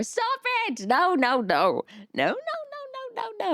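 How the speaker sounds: high, soft squeal of stop it; embarrassed by praise, wave it off; flustered protest. Real, not acted. Streamer flustered by viewers calling her pretty, squealing protest.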